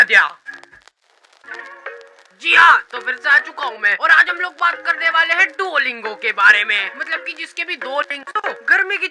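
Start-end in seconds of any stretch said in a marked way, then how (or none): none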